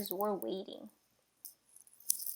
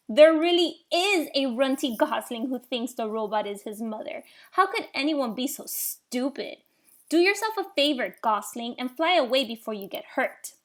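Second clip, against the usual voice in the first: mocking; 2 semitones higher, 8 dB louder than usual. A narrator's reading. Bear mocks and threatens gosling.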